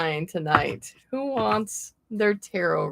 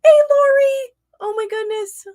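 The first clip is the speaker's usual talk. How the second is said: high, loud, near-squealing hellos; squealing with delight at familiar names; excited greeting; 13 semitones higher, 8 dB louder, faster than usual. Real, not acted. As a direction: gush over friends you haven't seen in ages.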